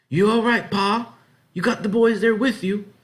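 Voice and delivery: high, loud call; calling out into the night. A narrator's reading. Farm wife shouts from the door to her husband, calling out. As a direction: call from the doorway to Pa.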